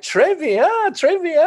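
very high-pitched mock crowd reply; imitated French fans. Skinhead girls answering the singer, very high imitated approval. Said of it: "and they were like".

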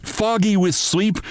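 ordinary delivery, nothing marked

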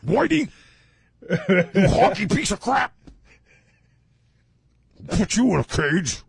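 shrill insulting woman. Acted. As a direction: spit the insult in a high voice. Acting the fiancée.

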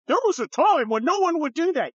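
high-pitched mimicry of the critics; mock objectors. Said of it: "they're like".

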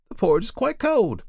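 high-pitched contradicting woman; high, dismissive character voice; insistent woman. A narrator's reading. Woman dismisses the man's complaint.

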